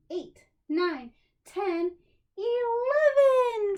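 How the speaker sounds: put-on counting with kids, rising to a long high eleven; building count. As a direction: count up to a big finish.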